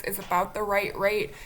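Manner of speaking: usual speaking voice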